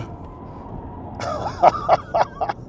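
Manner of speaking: very high airy squeal of laughter; shrieking laugh. Real, not acted. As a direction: squeal with delight. Thrilled on track.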